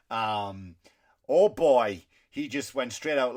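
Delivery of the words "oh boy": exclaimed much louder in disbelief. Real, not acted. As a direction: blurt it out, stunned. Reacting to a shocking story.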